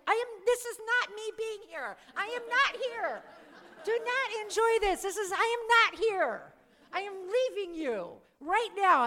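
high, strained mock outrage; acted indignation. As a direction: protest hotly in a high, forced voice. Acting out her indignant refusal.

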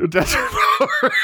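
laughing through the quote. Retold or imitated. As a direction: repeat it while laughing. Echoing quote while laughing.

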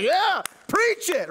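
high, slow, stretched "Yeah, preach it"; mock church hype. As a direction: ham up a fired-up congregation member. Imitating people in Dallas.

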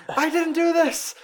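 high, slow, wailing denial; panicked scream. Retold or imitated. Friend mimicking the actor's panicked high scream. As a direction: howl the denial like a terrified man. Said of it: "it's like".

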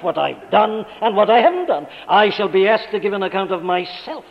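loud, driving sermon voice; forceful preaching. Acted. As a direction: press the point home from the pulpit.

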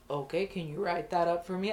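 lightly voiced lieutenant; a hint of a deep-voiced boss making a request; Richards. Lieutenant asking for a write-up, lightly imitated deep voice. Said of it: "he talked in this really low, deep voice, slow".

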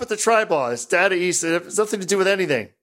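high, exasperated scolding of a co-host; fed-up protest. Real, not acted. Mock exasperation at co-host's repeated heckle.

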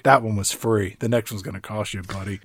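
low, hushed warning; very soft, low, trailing off; sly aside. Acted. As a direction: murmur it under your breath to a customer. Voicing hustler's aside after a hug.

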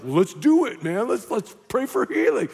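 lightly voiced gung-ho friend; a hint of a keen young believer; eager believer. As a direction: imitate an eager friend urging you on. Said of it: "young woman of faith".

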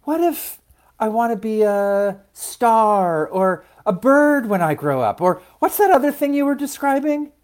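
high-pitched, wondering seed voice; dreamy child-like character, pitched well up; dreamy little seed. A narrator's reading. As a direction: wonder aloud in a small high voice.

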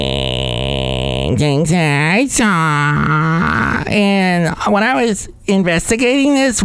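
high creaky vocal fry pagan podcaster; a pagan girl host, words crackling in vocal fry up high. Acted. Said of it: "with total vocal fry girl".

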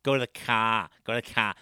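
"car" as "cah", amateur Boston; mock Boston accent. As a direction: drop the r in "car", Boston-style. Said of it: "Whenever you say Boston accent".

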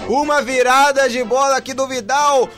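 roaring goal call, drawn-out and high; explosive goal commentary. Real, not acted. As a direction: scream the goal with full joy. Goal scored, narrator erupts.

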